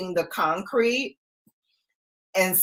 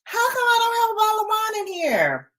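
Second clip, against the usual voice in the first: much higher, fast mock outrage; indignant demand; faster than usual. Mom to her kids about the missing wine, high and quick. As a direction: squeal it like you've been robbed. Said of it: "and be like".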